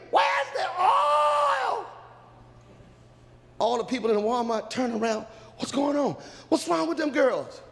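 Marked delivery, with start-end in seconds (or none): 0.1-1.8 very high, drawn-out frantic yell; acted desperate shriek; screaming. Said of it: "busted up into the Walmart screaming". 5.6-7.6 higher-pitched questioning; acted bewilderment, voice raised; puzzled shoppers. Shoppers puzzled by the screaming girls.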